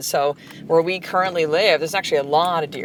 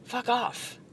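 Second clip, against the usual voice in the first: a quiet, half-voiced tired snap; exhausted, irritated retort; 11 dB quieter than usual. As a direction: mutter it, too worn out to raise your voice. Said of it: "I was just like".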